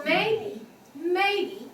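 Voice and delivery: high, held "may", then dropped "be"; first-tone "Maybe". Shown as a demonstration. Teacher shows English word has tones, high-level "Maybe". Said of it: "that's the first tone".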